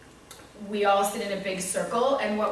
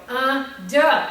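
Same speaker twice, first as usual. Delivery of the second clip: loud, higher attention call; louder call with annoyance showing; annoyed; 5 semitones higher, 10 dB louder than usual. Retold or imitated. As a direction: bark the class cue, fed up. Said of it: "'cause now I'm annoyed".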